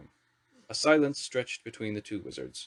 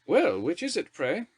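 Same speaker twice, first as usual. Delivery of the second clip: higher, teasing, lightly mocking question; bantering; 8 semitones higher, 4 dB louder, slower than usual. A narrator's reading. Prime Minister teasing the wizards in a bantering tone. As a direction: tease the nervous wizard. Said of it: "in an almost bantering tone".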